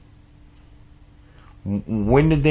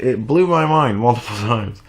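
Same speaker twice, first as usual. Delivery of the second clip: ordinary talk, nothing marked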